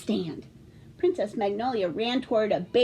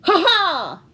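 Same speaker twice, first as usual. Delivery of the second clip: heroic laugh; 6 semitones higher, 8 dB louder than usual. Acted. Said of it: "and said".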